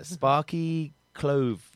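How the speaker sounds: slow, savoured naming; slow drawn-out name. Acted. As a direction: stretch the name out slowly.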